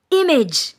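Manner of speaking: IMAGE punched loud and high, falling sharply; emphatic stress. Shown as a demonstration. Preacher demonstrating emphasis so nobody is confused, emphatic IMAGE. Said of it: "This is emphasis".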